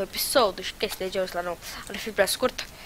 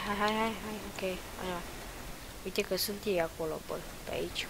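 ordinary talk

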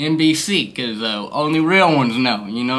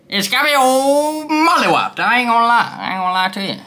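silly put-on voice; 8 semitones higher than usual. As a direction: stretch it out high and silly like you're baked. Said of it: "I don't know why I'm doing a voice like this".

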